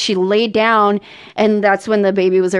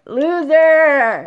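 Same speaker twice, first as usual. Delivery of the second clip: taunting; 5 semitones higher than usual. Real, not acted. Taunting friend who is behind on show.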